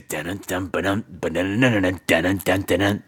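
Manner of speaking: ordinary talk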